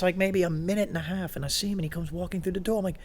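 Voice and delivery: ordinary delivery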